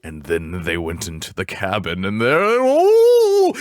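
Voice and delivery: spooky campfire tale with a sudden jump; creepy narrator voice. Retold or imitated. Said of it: "so I gotta get the creepy voice".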